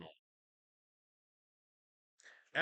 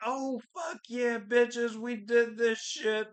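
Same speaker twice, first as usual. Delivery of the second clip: mock triumph; 8 semitones higher, slower than usual. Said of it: "people are like".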